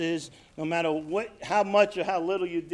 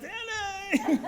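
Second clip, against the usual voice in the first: very high, long beckoning call; calling dope. Retold or imitated. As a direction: sing out temptingly in a tiny high voice. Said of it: "the dope is going".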